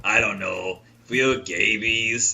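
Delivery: slow rough mock Fierstein; Harvey Fierstein voice. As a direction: drag it out hoarsely. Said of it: "he goes".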